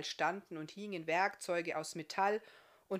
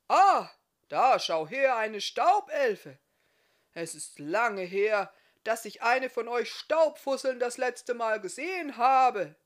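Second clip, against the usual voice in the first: much louder and higher, slow booming delight; delighted blacksmith booming at the dust elf; 4 semitones higher, 12 dB louder than usual. Acted. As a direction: boom out the surprise at the elf. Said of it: "dröhnte er mit lauter Stimme".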